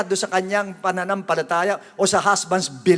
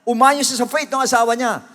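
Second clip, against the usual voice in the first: normal talking voice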